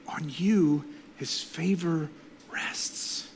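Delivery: trailing whisper; blessing fading to a whisper. Real, not acted. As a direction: let the last word fall into a whisper. Blessing ends.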